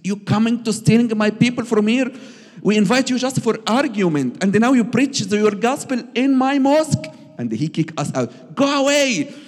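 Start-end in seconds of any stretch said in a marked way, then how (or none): 0.0-7.1 much higher, louder, furious accusing voice; angry accusation. Retold or imitated. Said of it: "he got angry and said". 8.5-9.3 much higher, louder yell; angry shout. Retold or imitated. Leader kicks them out.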